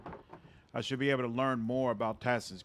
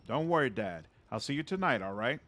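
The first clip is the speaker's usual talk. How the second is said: ordinary talk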